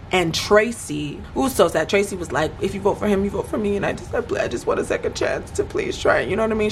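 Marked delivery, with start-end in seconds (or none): 2.6-6.2 imitated tearful plea, rising to a high squeak; mock near-crying. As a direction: plead as if about to cry, voice climbing high. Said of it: "She starts crying almost".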